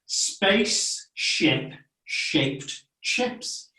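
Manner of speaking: very slow, breathy Spaceship shaped chips; slow model. Shown as a demonstration. Teacher slows the tricky phrase for the child, very slowly. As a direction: stretch each word out slowly. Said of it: "So it goes like this".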